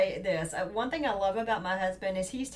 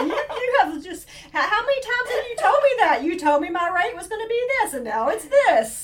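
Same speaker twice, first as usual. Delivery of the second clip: high, loud mock rant; hammed-up customer outrage down the phone; retold irate yelling; 10 semitones higher, 8 dB louder than usual. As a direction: imitate a fed-up husband telling off an agent. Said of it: "he's yelling at somebody".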